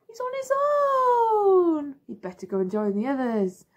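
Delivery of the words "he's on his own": mock gasp. Gasp at the lonely frog.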